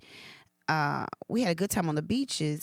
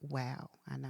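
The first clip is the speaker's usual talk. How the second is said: awed hush; 2 semitones lower, 10 dB quieter than usual. Retold or imitated. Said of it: "And I was like".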